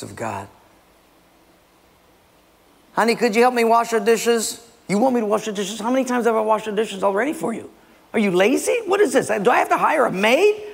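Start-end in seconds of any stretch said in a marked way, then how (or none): 2.9-4.6 higher-pitched wife voice; imitated wife asking for help; wife's request. Wife's request in second acted quarrel. 4.9-10.6 high, incredulous husband; hammed-up marital griping; acted indignation.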